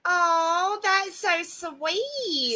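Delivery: long, drawn-out sweet coo; touched gushing. Real, not acted. Co-host to an admiring viewer, gushing.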